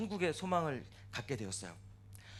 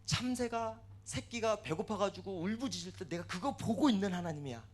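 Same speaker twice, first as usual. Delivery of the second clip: acted divine wrath, loud burst then sustained raised voice; wrathful rebuke; 5 semitones higher, 7 dB louder than usual. God rebukes Job for misjudging him, wrathful. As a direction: thunder the opening, then keep pressing higher. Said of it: "막 진노하시면서".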